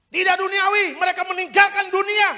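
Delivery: loud, high, shouted preaching; full-force revival preaching; fiery preaching. Acted. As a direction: thunder repentance at the congregation.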